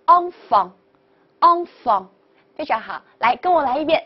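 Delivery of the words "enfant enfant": "enfant" slowly, both syllables in nasal on; blended model pronunciation. Shown as a demonstration. Teacher models the word for child, slow nasal vowels. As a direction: draw out "enfant" clearly, pausing between takes. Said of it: "所以连起来就是".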